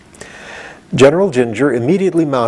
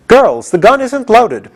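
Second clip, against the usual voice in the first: high, loud, slow announcement; cried out; 11 semitones higher, 4 dB louder, slower than usual. Acted. General Ginger calls to her army, high and loud. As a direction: call out triumphantly.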